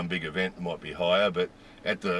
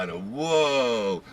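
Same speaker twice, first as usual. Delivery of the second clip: ordinary talk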